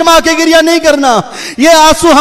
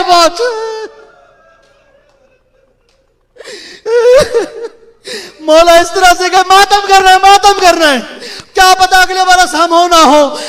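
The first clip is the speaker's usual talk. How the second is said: preacher moving mourners to weep; lamenting majlis recitation. Acted. Preacher reciting masaib to the mourners, in a rising mournful chant.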